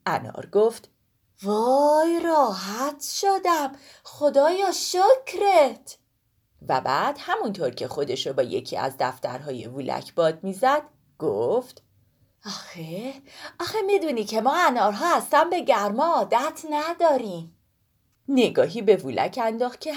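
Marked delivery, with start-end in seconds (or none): 1.4-6.0 high, slow sigh of relief; relieved pomegranate. Acted. Pomegranate freed from the pocket thanks God, slow and high. 12.5-17.6 fanning itself while it chatters; chatty pomegranate. Acted. Pomegranate fans itself, explains.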